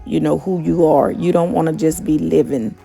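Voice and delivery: normal talking voice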